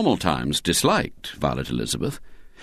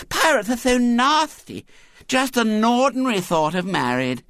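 put-on high piping child, bossily explaining; prim small-girl voice; fussy lisping little girl; 13 semitones higher than usual. A narrator's reading. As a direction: protest then prattle on girlishly.

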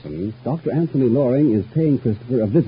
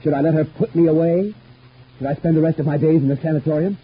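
high, louder, desperate questions; agitated protest; 8 semitones higher, 4 dB louder than usual. Acted. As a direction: protest against being locked away. Agitated protest at being put away.

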